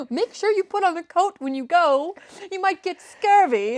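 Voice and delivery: put-on high-pitched fussing old lady; fussy old aunt.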